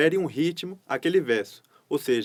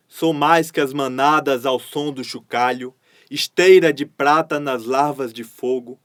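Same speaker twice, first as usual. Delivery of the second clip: louder, rhythmic galloping poem reading; poem recital; 2 semitones lower, 5 dB louder than usual. Acted. Teacher reading a poem aloud to her class, declaimed with a galloping rhythm. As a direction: declaim each line to the steady gallop beat.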